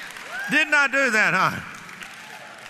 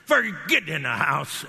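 firm command; 2 semitones lower than usual. Retold or imitated. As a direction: say it like you mean business. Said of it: "she realized I meant business".

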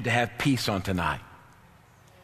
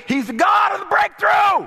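high, loud shout; preaching shout; 8 dB louder than usual. Acted. Preacher to congregation at the climax, huge shout. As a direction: scream the refrain at its peak.

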